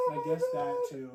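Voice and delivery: mock sheepish mom. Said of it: "is like".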